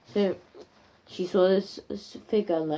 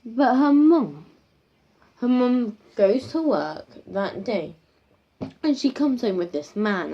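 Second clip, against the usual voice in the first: ordinary talk, nothing marked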